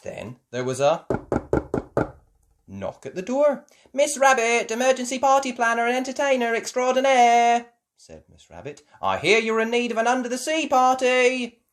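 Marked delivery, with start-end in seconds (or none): 3.9-7.7 high, loud showy self-introduction; grand announcement. Acted. Miss Rabbit presenting herself at the door, loud and high. 9.0-11.6 high, loud bustling helper; brisk can-do party planner; Miss Rabbit voice. Acted. Miss Rabbit to the Pig parents, high and loud.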